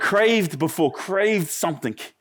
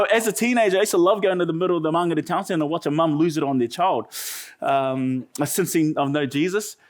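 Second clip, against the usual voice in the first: ordinary talk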